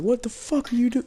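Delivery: high-pitched mock outburst; squeaky-high retold freak-out; losing her shit. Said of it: "She lost her shit".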